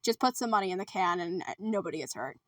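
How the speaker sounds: low, quiet mock threat; Pugsley. Pugsley asks Alice for money, quietly acted threat. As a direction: drop the voice and tail away softly. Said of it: "And Pugsley's like".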